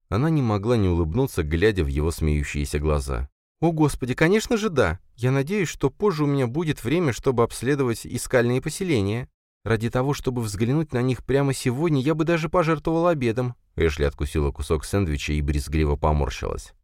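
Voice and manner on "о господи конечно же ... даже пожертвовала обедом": excited woman. A narrator's reading. Ashley excited reply.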